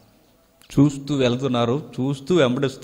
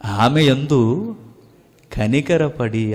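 slow savoring; much slower than usual. Shown as a demonstration. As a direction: linger tenderly on కనికరపడి, drawn out. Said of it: "ఆ మాట ఎంత బాగుందో చూడండి". (నెమ్మదిగా, మృదువుగా, ఆస్వాదిస్తూ)

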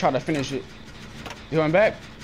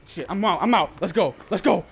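ordinary delivery

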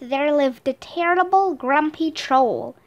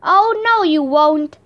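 shouted; 3 semitones higher, 6 dB louder than usual. Acted. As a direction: shout it back, defiant. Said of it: "the biggest goat shouted".

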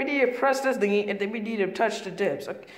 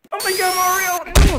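loud, higher excited cry; excited shout; 6 semitones higher, 9 dB louder than usual. Pretend. Sonic amazed at running fast, loud excited shout to Mario. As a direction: yell out in delight at the speed.

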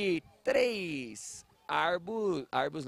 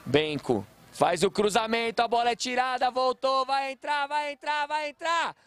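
rising higher and higher into a goal cry; building goal commentary; 8 semitones higher than usual. Real, not acted. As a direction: build the tension to a shouted goal. Cross, goal coming, rising call. (narração crescente até o grito de gol)

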